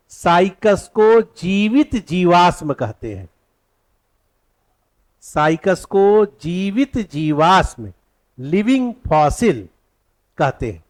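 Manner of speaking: slow, evenly spaced dictation. Shown as a demonstration. Teacher dictating the living fossil point, slow dictation. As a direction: stretch each term for note-takers. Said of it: "तो लिखिएगा".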